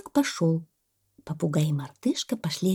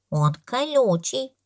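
slow, higher insistence; stubborn baby elephant; 6 semitones higher, slower than usual. A narrator's reading. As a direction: repeat it slowly and stubbornly. (слонёнок упрямится)

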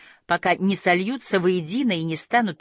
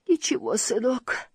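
higher, drowsy mother's voice; half-asleep murmur, pitched up; sleepy mumble; 7 semitones higher than usual. A narrator's reading. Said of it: "пробормотала во сне мама".

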